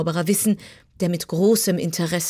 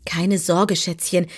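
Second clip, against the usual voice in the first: ordinary delivery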